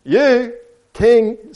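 high, loud incredulous goat; storybook goat jeering; mocking snicker. A narrator's reading. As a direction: scoff at the very idea. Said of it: "snickered Goat".

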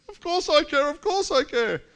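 flustered reassurance. Retold or imitated. You, caught out, flustered high placating. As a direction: scramble to reassure them. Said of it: "And you go".

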